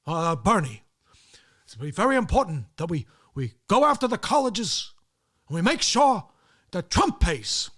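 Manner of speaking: hammed-up Biden lecturing Bernie; Joe Biden impression. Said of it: "That's another one".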